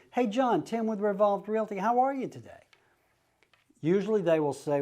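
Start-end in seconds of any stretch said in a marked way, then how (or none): 0.1-2.5 higher, easy friendly hello; casual greeting. Shown as a demonstration. Realtor greeting a seller on the phone, casual and friendly. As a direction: sound like he already knows you. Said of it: "So it's a very casual greeting".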